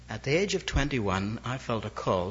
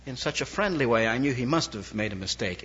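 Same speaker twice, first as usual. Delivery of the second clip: ordinary talk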